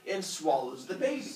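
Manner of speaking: usual speaking voice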